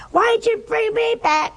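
high, indignant complaint; mad. Retold or imitated. Revived patient to doctor, indignant and high. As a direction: protest at being revived. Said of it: "he was so mad".

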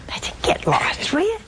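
unvoiced aside, get lost; whispered brush-off. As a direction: hiss it under your breath. Imagined retort.

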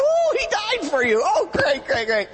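high, sarcastic oh, great, great; hammed-up sarcastic delight; mock excitement. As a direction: gush with fake enthusiasm. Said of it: "And I get all excited".

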